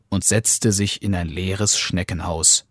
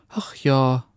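higher, sighed agreement; sighing wife; 5 semitones higher than usual. A narrator's reading. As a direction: sigh it out as the wife. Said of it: "antwortete die Frau und seufzte".

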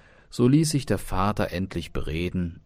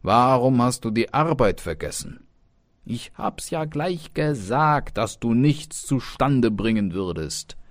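higher, louder reproach; exasperated parental telling-off; scolding father; 5 semitones higher, 6 dB louder than usual. Acted. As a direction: scold the boy as a fed-up father. Father scolds his idle son.

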